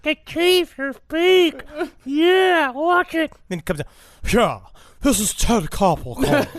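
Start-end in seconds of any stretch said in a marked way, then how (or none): 0.0-3.3 high, loud, slow put-on voice; hammed-up disguised caller; disguised crank-call voice. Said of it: "he's trying to cover up the voice". 4.3-6.5 lightly put-on disguised caller; a hint of a fake identity on the phone; mock newsman crank call; Ted Koppel. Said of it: "anything but his voice".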